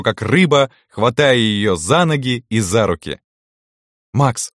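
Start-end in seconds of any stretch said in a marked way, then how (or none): 4.1-4.5 mild mock squeal, higher pitch; playful high-pitched threat. A narrator's reading. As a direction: squeal it lightly, without real shouting. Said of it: "визжала она".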